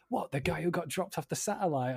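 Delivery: retold surprised tourist; imitated incredulous question. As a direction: ask as if it just clicked. Imitates American asking about Bond villain.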